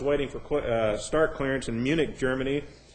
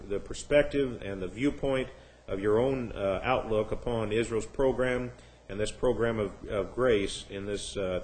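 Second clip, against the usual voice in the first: softer, subdued praying voice; public prayer; 3 semitones lower, 3 dB quieter than usual. Real, not acted. Pastor thanking God before the evening's Bible study, in hushed prayer. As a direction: lead a measured, quiet prayer.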